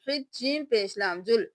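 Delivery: ordinary talk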